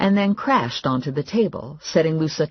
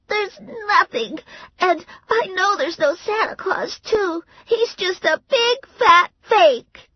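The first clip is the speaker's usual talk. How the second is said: very high, louder tearful pout; sulky child; 4 dB louder than usual. A narrator's reading. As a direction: blurt it out through sobs. Crying child.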